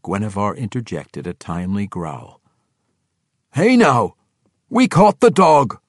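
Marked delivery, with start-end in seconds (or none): 3.5-5.8 higher, louder aggrieved whine; complaining young dwarf. Acted. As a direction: protest like a thwarted youth. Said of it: "Rollo complained".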